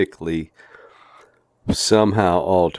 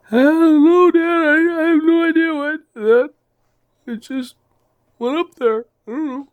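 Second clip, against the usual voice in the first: put-on much higher voice of a helpless little boy; 6 dB louder than usual. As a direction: squeak it out like a clueless kid caught by his dad. Little boy answers his dad.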